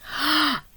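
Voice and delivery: soft dramatic intake of breath; little gasp; storytime gasp. Acted. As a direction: gasp to build suspense. Gasp before the quote.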